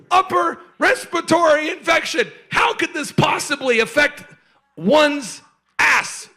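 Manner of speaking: loud, high, emphatic mock outrage; incredulous shouting. Husband explains the joke to his wife, shouting incredulously. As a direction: belt out each phrase in disbelief.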